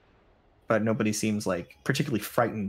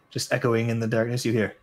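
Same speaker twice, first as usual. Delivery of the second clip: normal talking voice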